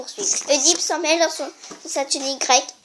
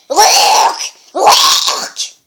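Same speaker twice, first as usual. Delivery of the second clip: rasping creature screech; sphinx cry; 3 semitones higher, 10 dB louder than usual. Acted. The sphinx screeching into the air. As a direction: screech like a beast. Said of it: "Le sphinx crie en l'air".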